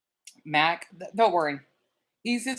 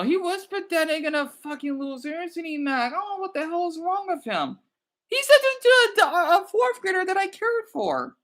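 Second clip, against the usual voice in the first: indignant rant; 11 semitones higher than usual. Real, not acted. Venting about the troll to Mac.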